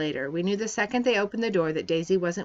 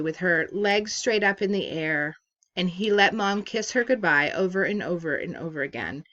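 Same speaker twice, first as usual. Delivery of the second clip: plain reading voice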